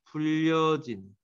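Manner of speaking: slow, spelled-out gloss for students to repeat; teacher drawing the word out for the class to follow, slowly; slow dictation. Shown as a demonstration. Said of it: "뭐뭐라고 따라와".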